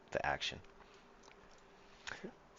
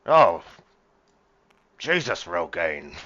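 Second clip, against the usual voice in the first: put-on loud, higher drunken captain's outburst; Greybeard, drunken; 10 semitones higher, 14 dB louder, slower than usual. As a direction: bellow slowly like an appalled drunk captain. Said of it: "do my best drunken Greybeard voice".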